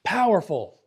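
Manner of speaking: POWERFUL punched high and loud, then falling; emphatic POWERFUL. Shown as a demonstration. Teacher showing what Yoda emphasizes, POWERFUL stressed. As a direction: hit "Powerful" hard on its own. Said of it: "What's he emphasizing?".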